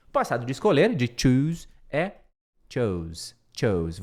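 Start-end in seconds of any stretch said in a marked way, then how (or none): 2.7-4.0 "chose" low, with the English o and z; short o sound. Shown as a demonstration. Teacher contrasting "chose" with "choose". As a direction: say "chose" with the o glide. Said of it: "com um O só".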